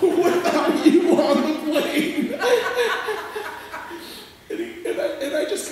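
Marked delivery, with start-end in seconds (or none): 0.0-2.4 slow, laughing through the words. Real, not acted. Storyteller to audience, breaking into laughter. 2.4-4.5 high, long helpless laugh; laughter. Real, not acted. Storyteller to audience, laughing hard.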